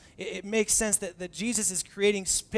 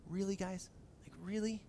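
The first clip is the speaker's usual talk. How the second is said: soft disbelief; 2 semitones higher, 10 dB quieter than usual. Retold or imitated. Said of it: "he's like".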